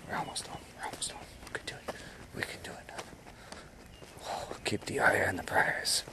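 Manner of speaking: hushed, whispered self-encouragement; whispered pep talk. Real, not acted. Tired walker urging himself home, very soft whisper. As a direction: whisper it to yourself, barely audible.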